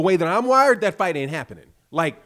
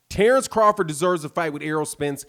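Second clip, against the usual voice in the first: normal talking voice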